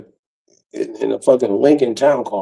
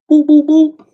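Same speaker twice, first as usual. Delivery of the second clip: loud, high "boop" knocking noises; punchy high vocal knocks; imitated door knock; 5 dB louder than usual. Knocking on his mama door, loud high boops.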